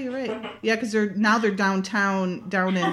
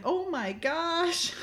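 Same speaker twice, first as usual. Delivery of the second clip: slow, high, breathy-free mock shock, pitched up; shocked gasp; 8 semitones higher, 5 dB quieter, much slower than usual. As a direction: play her stunned, drawn out slowly. Retelling Amanda's shocked reaction.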